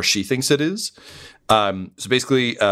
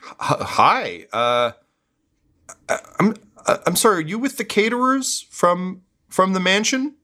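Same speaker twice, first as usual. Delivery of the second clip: higher, stammering, flustered voice; nervous guard; 10 semitones higher than usual. Acted. Guard nervously greeting the arriving crew. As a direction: stumble over your words, caught off guard. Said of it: "the guards say".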